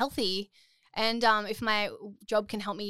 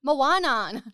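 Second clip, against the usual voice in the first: slow, drawn-out broad Bogan Moana; a hint of broad Bogan accent, slow; 6 semitones higher, 8 dB louder, much slower than usual. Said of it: "I'm like".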